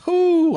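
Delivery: high, loud whoop; amazed whoop. Real, not acted. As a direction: whoop at a huge payday. Amazed at fifteen million payout.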